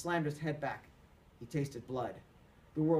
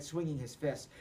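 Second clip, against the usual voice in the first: ordinary delivery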